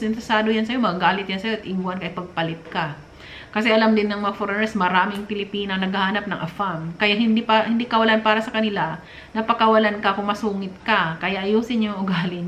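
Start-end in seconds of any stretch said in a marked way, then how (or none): none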